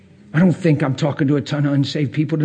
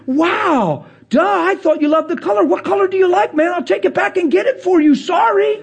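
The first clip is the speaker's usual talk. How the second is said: mock gracious giver; 13 semitones higher than usual. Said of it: "then you'd say".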